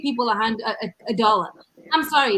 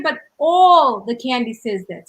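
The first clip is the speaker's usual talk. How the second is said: firm objection; 7 dB louder, slower than usual. Retold or imitated. Arguing the price with clerk.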